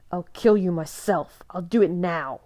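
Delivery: loud, seething threat; furious threat. A narrator's reading. Jacob threatens Edward, seething with fury. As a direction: promise death through rage.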